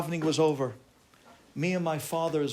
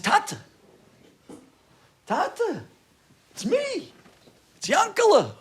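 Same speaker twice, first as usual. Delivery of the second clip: high, urgent calls to father; calling out; 7 dB louder than usual. Retold or imitated. Son whose father walks right by, urgent high calling. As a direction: plead loudly to be recognized. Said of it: "And I start screaming".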